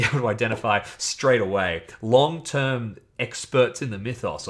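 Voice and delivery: usual speaking voice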